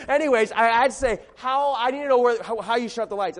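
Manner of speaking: loud, driving pulpit preaching; preaching at full force. Acted. Pastor preaching hard to his congregation, loud and driving. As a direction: preach it out to the whole room, full voice.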